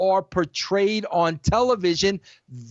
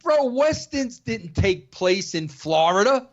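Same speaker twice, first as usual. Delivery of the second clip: ordinary talk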